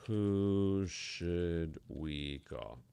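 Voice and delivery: very slow, stretching every word; drawn-out musing. Real, not acted. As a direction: mull it over slowly, dragging each word out. Musing over whom to prank call.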